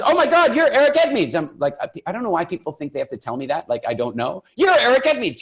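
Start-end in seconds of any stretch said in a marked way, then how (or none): 0.0-1.4 high, loud, starstruck fan gushing; imitating an excited fan, much higher and louder. Starstruck fan recognizes speaker. 4.6-5.4 high, loud, starstruck fan gushing; imitating an excited fan, much higher and louder. Fan repeats recognition, starstruck.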